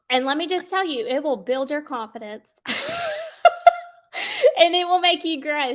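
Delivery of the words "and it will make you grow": laughing. Real, not acted. Laughs while teaching.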